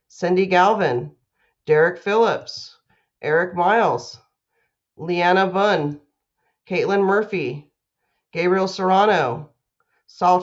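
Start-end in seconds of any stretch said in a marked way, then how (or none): none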